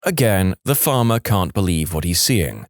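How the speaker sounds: ordinary talk, nothing marked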